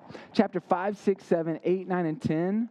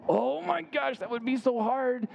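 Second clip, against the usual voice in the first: mock gasp; 7 semitones higher than usual. Group's reaction acted out.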